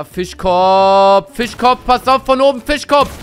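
high, loud urgent call; warning shout. Real, not acted. Streamer warning Fischkopf of an enemy above, loud high shout. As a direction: yell a warning at the screen.

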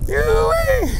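high, loud whoop of joy; joyful whoop. Real, not acted. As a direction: whoop it out with delight. Whooping out on the water.

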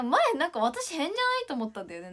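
ordinary talk

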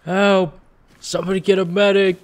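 yelling for a medic; panicked call for help, loud and high; man down shout. Real, not acted. Teammate down, calling for help.

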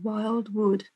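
ordinary delivery

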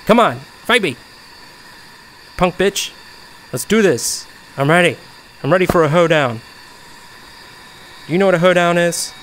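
loud cocky taunts; fake confidence. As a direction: pretend to be fearless. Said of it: "I'm acting, like, so confident".

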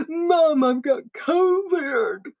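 mock tearful wail, slow and dragged; crying daughter. Daughter on the phone to her mum, tearfully. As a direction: whimper it out like a sobbing teen.

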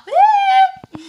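very high, loud whoop; hyper whoop. Real, not acted. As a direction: whoop like you're wired on Coke. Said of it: "Sorry, I'm so hyper".

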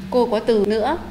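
normal talking voice

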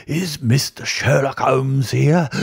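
put-on high, slow quavering old man; creaky old-timer asking at the door. A narrator's reading. Old man voiced by narrator.

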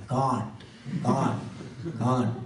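put-on Bengali-accented "can't", each one separate; heavy Bengali accent. People answering the teacher they can't, imitated Bengali accent. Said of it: "he had a very heavy Bengali accent".

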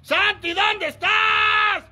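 high, slow shouted call. Real, not acted. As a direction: draw the call out long and loud. Searching school, calling name.

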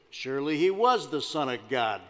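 deep, deliberate film-star delivery; John Wayne. Acted. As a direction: do a hammy Western film-star impression. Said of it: "John Wayne played the centurion".

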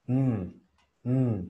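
ordinary talk

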